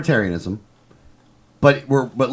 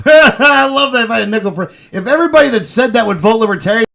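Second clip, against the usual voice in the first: high, loud, amused outburst; laughing; 10 semitones higher, 5 dB louder than usual. Real, not acted. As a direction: talk through a real laugh. Host cracks up at caller's claim.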